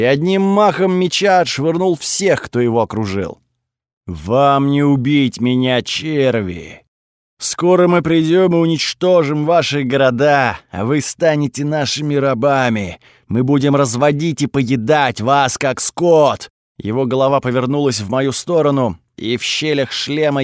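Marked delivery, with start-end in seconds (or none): 4.1-5.8 slow, looming threat from inside a helmet; menacing god voice. Acted. Sun god threatens in his voice. 5.8-6.8 contemptuous spit of a helmeted god; menacing god voice. Acted. Sun god threatens, same insult. 7.4-16.5 loom over them, each word weighed; menacing god voice. Acted. Sun god threatens the crowd.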